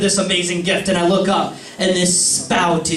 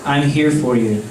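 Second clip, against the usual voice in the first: low, gentle reassurance; calm, hushed mothering voice; soothing water mother; 4 semitones lower, slower than usual. Acted. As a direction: comfort tenderly, dropping to a hush on the name. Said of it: "Water mother just continues spouting".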